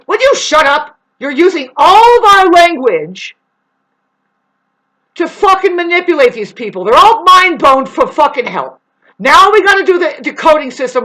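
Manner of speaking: high, loud tirade; furious high-pitched rant; angry outburst. Acted. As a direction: shout them down, high and hard. Heated argument with imagined beings.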